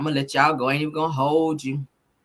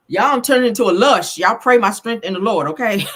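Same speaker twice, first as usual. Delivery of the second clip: tipsy exclaiming; 3 semitones higher, 8 dB louder than usual. Real, not acted. Tipsy host joking to viewers.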